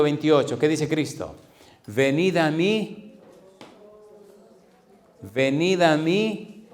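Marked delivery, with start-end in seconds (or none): 1.9-6.7 slowly drawn out, inviting; slow invitation. Acted. Preacher to his congregation, voicing Jesus's call, slow and inviting. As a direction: lay each word down slowly, beckoning.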